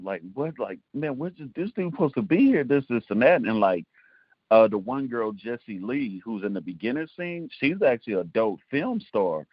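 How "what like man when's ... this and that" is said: higher-pitched, mildly panicked retelling; a touch of exasperated panic, retold; retold freaking out. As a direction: replay a last-minute fret, pitched up. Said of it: "freaking out".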